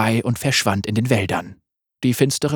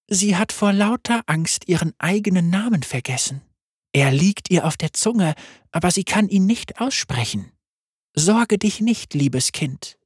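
deutlich höher, sanft und verspielt; helle Lamm-Stimme; 7 semitones higher than usual. Acted. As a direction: ein unheimlich freundliches Lamm. Lam voice again. (Lamm stellt sich vor, hoch und sanft)

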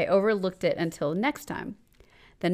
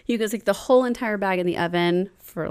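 ordinary talk

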